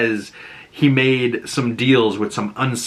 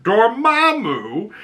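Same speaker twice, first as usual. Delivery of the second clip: mock devil voice; 6 semitones higher, slower than usual. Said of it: "and he's like".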